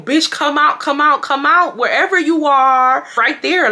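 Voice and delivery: loud, high demanding call; retold insistent holler; calling someone out. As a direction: order them out of hiding, loud and high. Calls friend out of hiding.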